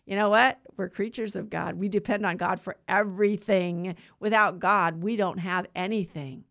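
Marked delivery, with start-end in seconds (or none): none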